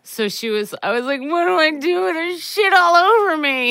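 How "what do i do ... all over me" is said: high, loud panicked plea. Retold or imitated. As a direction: cry out for help in panic. Retelling her panic.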